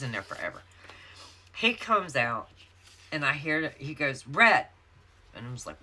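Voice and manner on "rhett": loud, higher-pitched mock yell; husband calling out. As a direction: imitate an angry husband calling her name.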